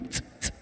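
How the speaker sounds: quiet unvoiced tss hiss; soft hi-hat hiss. Shown as a demonstration. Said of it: "That's sound number two".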